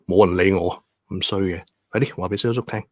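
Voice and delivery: usual speaking voice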